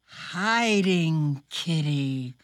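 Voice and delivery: slow drawn-out reading; storybook reading. Acted. Host reading the picture book page, slow. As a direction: read it slow for the kids.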